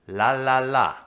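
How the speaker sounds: la la la with a dark L; dark L demo. Shown as a demonstration. Teacher demonstrating her own L. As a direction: sing out the la with the tongue back. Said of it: "That's what I do".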